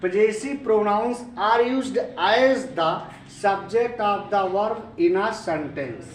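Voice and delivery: slow, connected reading at full voice; steady, slow classroom recitation without long gaps; measured read-back. Real, not acted. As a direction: read the finished definition out deliberately. Reading the finished definition back to class.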